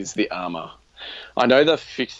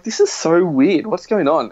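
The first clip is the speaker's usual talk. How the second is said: higher, baffled retelling; raised-pitch mock bafflement; retold bewilderment; 7 semitones higher, 5 dB louder than usual. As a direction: puzzle aloud as if back in that moment. Retold bewilderment at strange beeping.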